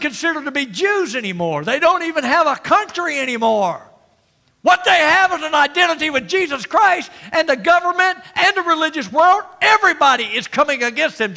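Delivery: high, loud preacher's proclamation; forceful preaching. Acted. Preacher to congregation about believers under attack, forceful preaching.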